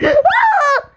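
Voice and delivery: gasp, then scream high in fright; startled scream. Real, not acted. Player surprised by a fast enemy, startled scream.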